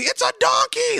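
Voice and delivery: put-on high, loud squeal of an excited little boy; squeaky kid voice. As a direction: squeal it like a toddler spotting a toy. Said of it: "he was like".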